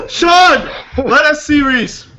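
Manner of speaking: high, loud call with laughter around it. Real, not acted. Calling to Sean to show Reese, a high laughing shout.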